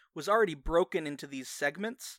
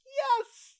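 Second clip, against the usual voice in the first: excited cheer; 3 dB louder than usual. Real, not acted.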